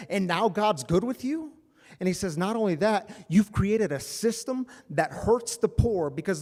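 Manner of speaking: loud, high, indignant preaching; Jesus rebuking, preached. Acted. Jesus rebuking the temple sellers, loud and indignant. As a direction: tear into the system like turning tables.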